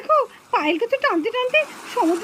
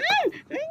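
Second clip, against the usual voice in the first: muffled, desperate hums through a covered mouth; muffled struggling cries; 6 semitones higher, much slower than usual. Acted. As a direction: fight against a hand over the mouth. Mouth held shut, struggling. (মুখ চেপে ধরা গোঙানি)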